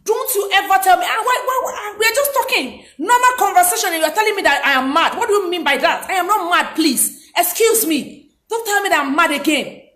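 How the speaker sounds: acted high-pitched indignant protest; angry outburst. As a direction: protest heatedly, pitch jumping up. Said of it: "Anger involves loud emotional outbursts".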